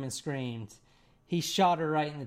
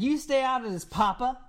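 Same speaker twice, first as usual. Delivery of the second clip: higher-pitched quoted warning to father; retold warning; 8 semitones higher than usual. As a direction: warn Papa off, imitating the son. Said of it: "That was his direct quote".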